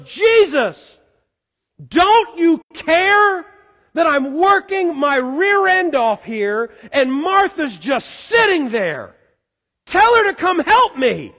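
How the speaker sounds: loud, high, exasperated shouting at Jesus; frustrated yell. Acted. Martha yelling at Jesus to make her sister help. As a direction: yell it like you're fed up and overworked. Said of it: "I'm just gonna assume that she yelled".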